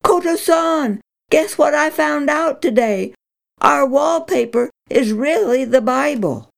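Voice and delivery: high, bright calling out of news; excited calling out. Acted. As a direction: burst out with the news. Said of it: "Grandmother cried".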